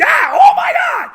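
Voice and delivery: high, loud yelp of fake pain; mock startled shout. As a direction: yelp like the seat just stung you. Stung by chair in 4D ride.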